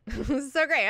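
laughing delight. Real, not acted. Laughs while repeating it.

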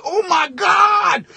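high, slow stunned oh my God; high and drawn-out horror; shocked. Retold or imitated. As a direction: gasp it out in slow disbelief. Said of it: "And I'm like".

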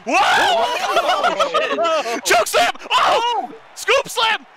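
very high screamed move calls; astonished play-by-play. Real, not acted. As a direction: scream each slam as it lands. Astonished at a run of slams.

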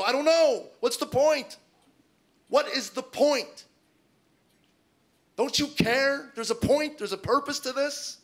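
high, loud despairing plea; exasperated rant. Retold or imitated. Husband asked why he can't enjoy his job, exasperated rant.

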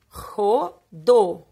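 "Rodo" split into RO, pause, DO; syllable by syllable. Shown as a demonstration. Teacher shows pupils both O sounds, syllable by syllable. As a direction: break "Rodo" in two, each O sounded clearly. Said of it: "Duas vezes eu ouço o som da vogal O".

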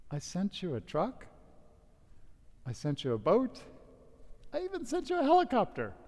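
God, smiling down at the drowned man; amused reply. Acted. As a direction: patiently point out the obvious, smiling. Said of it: "smiled and looked down and said".

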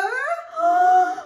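very high, loud gasp; frightened gasp. Acted. Storyteller acting Miss Muffet's fright, loud high gasp.

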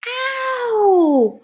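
very high, loud, drawn-out yelp; cry of pain. Acted. Maker just smashed her finger, high pained yelp. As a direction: wail it high in playful pain.

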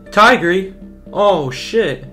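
very loud, high startled outburst; shocked. Real, not acted. Player seeing Tigry suddenly turn up, shocked. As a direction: blurt it out in real shock. Character suddenly appears on screen.

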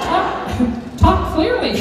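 high, slow squawked command; high commanding parrot squawk; bossy parrot. Retold or imitated. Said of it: "Alex commanded".

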